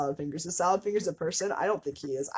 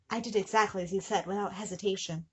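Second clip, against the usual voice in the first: normal talking voice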